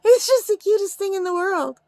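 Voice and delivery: giddy, giggly gushing over a cute toy. Real, not acted. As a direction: gush through a real giggle. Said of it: "I can't stop giggling".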